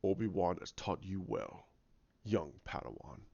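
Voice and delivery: low, soft, measured imitation; sinister master voice. Dark figure addressing young Padawan, low and ominous. Acts villain's line from films.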